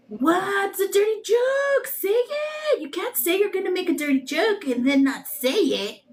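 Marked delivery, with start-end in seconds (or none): 0.1-2.8 high, drawn-out mock outrage; playfully indignant, pitched way up; mock demand. Acts out viewers demanding the joke.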